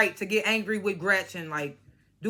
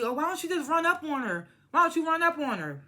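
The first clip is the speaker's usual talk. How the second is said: lightly mimicked goading, pitched up; higher voice imitating someone urging a fight; mock egging on; 6 semitones higher than usual. Mimicking Meatball telling a friend to attack, mock egging on.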